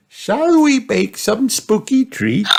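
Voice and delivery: high, slow granny voice; Granny Pig. A narrator's reading. Granny agreeing to her grandchild's request, high slow granny voice. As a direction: draw out the reply in a high voice. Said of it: "said Granny Pig".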